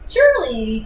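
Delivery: ordinary delivery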